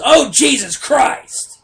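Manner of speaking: drawn-out, high exasperated sigh of a customer; a put-upon old lady groaning it out slowly. Retold or imitated. Old customer sighing at the cashier, slow and exasperated. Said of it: "she just looks at you and goes".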